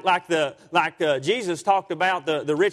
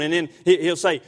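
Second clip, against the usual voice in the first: ordinary talk, nothing marked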